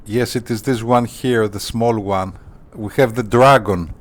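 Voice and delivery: ordinary delivery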